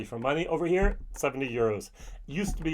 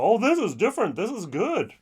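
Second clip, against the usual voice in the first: higher and louder, oh of discovery; pleasantly surprised; 7 semitones higher, 3 dB louder than usual. Retold or imitated. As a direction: taste it and be won over. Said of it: "and they go".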